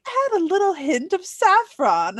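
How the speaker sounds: high, slow, fancy recipe voice; posh recipe voice. Retold or imitated. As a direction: read it like a snooty cookbook. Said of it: "when it was like".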